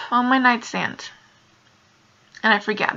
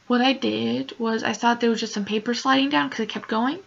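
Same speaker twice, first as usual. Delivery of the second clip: ordinary talk, nothing marked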